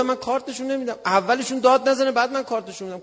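retold stubborn protest; defiant refusal.

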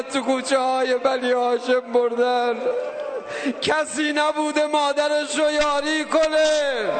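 high, sustained wailing cry; full-force lament. Real, not acted. Climax of the lament. (روضهٔ پرشور با فریاد کشیده)

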